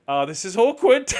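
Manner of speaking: high and loud, laughing through the words; awkward laugh. Retold or imitated. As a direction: laugh it off in mock embarrassment. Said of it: "I went".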